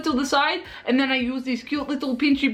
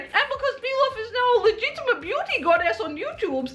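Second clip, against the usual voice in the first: hammed-up excitement; 11 semitones higher than usual. Hammed-up sexy slow-mo intro.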